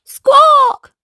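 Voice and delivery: high, loud squawk; parrot squawk. Acted. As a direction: screech like a startled parrot. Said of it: "goes Parrot".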